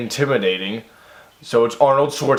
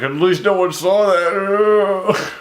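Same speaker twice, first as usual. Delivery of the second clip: mock smug line; 6 semitones higher, much slower than usual. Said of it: "you're like".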